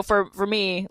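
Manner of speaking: ordinary delivery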